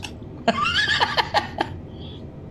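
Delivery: high breathy giggle; giggling laugh. Real, not acted. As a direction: burst into a giggle. Laughs at landing.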